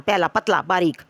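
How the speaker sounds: ordinary talk, nothing marked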